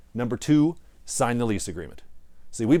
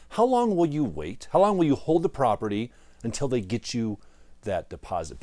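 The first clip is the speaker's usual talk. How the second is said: usual speaking voice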